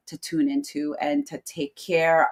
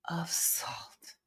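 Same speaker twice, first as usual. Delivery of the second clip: breathy whisper on the last words; whispered grain of salt; whispered aside; 12 dB quieter than usual. Real, not acted. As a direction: drop to a confiding whisper.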